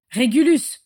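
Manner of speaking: high, loud call of the name; shouting. A narrator's reading. Father calling from the fireplace, shouting. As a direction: shout for your son. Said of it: "cria Orion depuis la cheminée".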